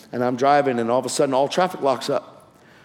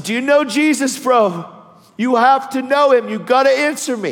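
raised, high-pitched urgent appeal; urgent plea; 9 semitones higher, 5 dB louder than usual. Retold or imitated. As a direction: press a dying man for an answer. Pleading with dying man for answer.

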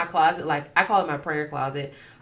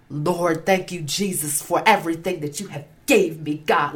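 mock spooky prayer. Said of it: "Like, very spooky, spiritual".

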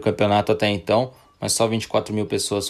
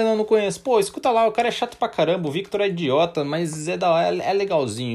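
friend voice; 7 semitones higher, faster than usual. Acted. Acted friends recommending the podcast.

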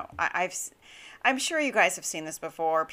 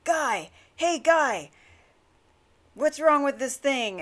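high-pitched mock call to an attendant; retold shout, demanding help; imitated shout; 6 semitones higher than usual. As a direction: imitate an irate customer hailing staff. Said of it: "he shouted at the attendant".

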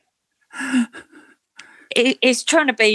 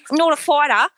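higher-pitched mock Aussie twang; bad Australian accent; 6 semitones higher than usual. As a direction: imitate her in a badly done Australian accent. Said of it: "excuse my bad Australian accent".

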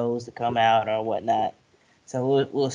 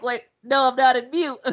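high voice; 10 semitones higher, 4 dB louder than usual. Retold or imitated. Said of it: "She was like".

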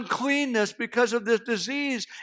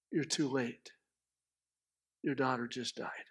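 low, hushed delivery; grave news-breaking; 8 semitones lower, 13 dB quieter than usual. Acted. Somebody runs up to tell Jairus, grave and quiet. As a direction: break terrible news softly.

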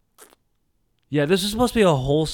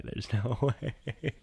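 soft slow laugh in the middle of "no way"; 10 dB quieter, much slower than usual. Real, not acted. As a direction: let a laugh break the refusal. Laughs mid-refusal.

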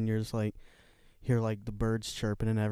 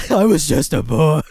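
loud, higher mock superhero cry; hammed-up tortured vigilante; Batman; 5 semitones higher, 14 dB louder than usual. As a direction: belt it out as a wounded caped hero. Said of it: "you wanna wake up to Batman".

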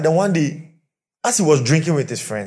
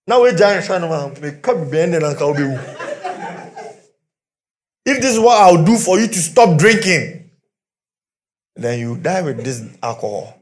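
louder, indignant wife complaining; defiant wife; 3 semitones higher, 6 dB louder than usual. Retold or imitated. Preacher voicing a fed-up wife refusing her drunk husband.

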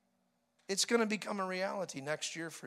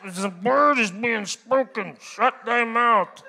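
slow, loud, solemn "shut thy mouth"; mock stern preacher; 10 dB louder, slower than usual. Pastor trying to preach over the laughing, slow loud mock solemnity. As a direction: lay it down like an old-time preacher.

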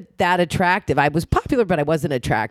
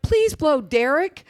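higher, slow mock begging; pleading; 11 semitones higher, slower than usual. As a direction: drag it out, begging her friend. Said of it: "And I'm like".

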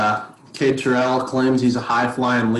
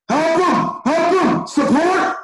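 mock coach yelling; 3 dB louder, slower than usual. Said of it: "Within a second of me turning".

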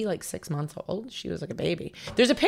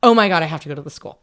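much louder and fast, bursting with excitement; thrilled rush; 9 dB louder, faster than usual. Retold or imitated. Future chef hearing Bobby Flay attended, thrilled outburst. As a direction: blurt it out like you just can't wait. Retelling her thrilled inner reaction.

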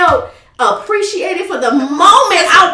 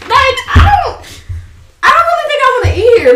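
exasperated; 10 semitones higher than usual. Retold or imitated. Retelling disgust at restaurant.

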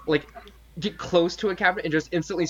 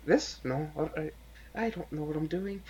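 quiet nervous aside; 9 dB quieter than usual. Acted. Character whispers to raven.

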